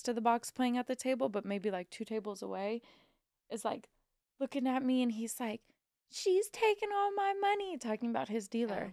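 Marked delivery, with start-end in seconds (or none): 6.1-7.8 high-pitched imitated gripe; imitating an aggrieved gambler, pitched up; mock player's complaint. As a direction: complain in a raised, put-on voice. Acted player complaining about his dealer.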